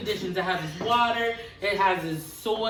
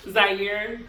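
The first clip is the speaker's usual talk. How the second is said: ordinary delivery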